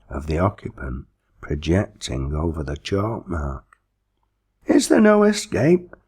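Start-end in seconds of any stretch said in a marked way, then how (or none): 4.7-6.0 high, pleading wail; desperate plaintive cry. A narrator's reading. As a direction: beg plaintively, much higher. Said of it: "plaintively asked the victim".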